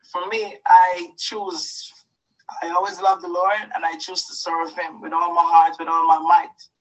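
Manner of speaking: ordinary delivery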